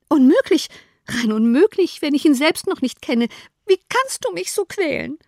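high, wailing complaint; distressed protest. A narrator's reading. Wife refusing her husband's suggestion, distressed high protest.

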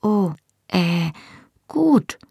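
falter, then agree uncertainly; unsure. A narrator's reading. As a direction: thrown off, halting and high. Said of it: "sagte Hamish unsicher".

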